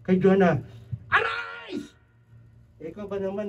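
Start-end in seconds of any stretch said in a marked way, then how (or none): 1.1-1.9 very high clipped command; commanding call. Retold or imitated. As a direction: bark the order. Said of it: "It's a command".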